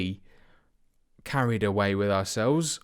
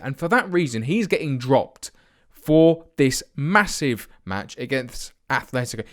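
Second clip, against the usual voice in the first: ordinary delivery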